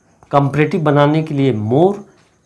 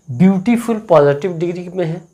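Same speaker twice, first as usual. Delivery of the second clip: usual speaking voice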